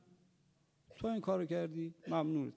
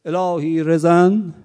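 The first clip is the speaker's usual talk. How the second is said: الهی رزن called out slow and loud, dwelling on رزن; preacher's slow loud cry of الهی رزن; loud drawn-out invocation; 13 dB louder, much slower than usual. Acted. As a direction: proclaim الهی رزن slowly and loudly to the crowd.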